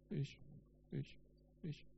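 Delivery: a halting, tearful stammer, barely getting it out. A narrator's reading. The boy confesses to Jesus at the crib, halting stammer. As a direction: stumble over each word as if near tears. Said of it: "brachte er mühsam heraus".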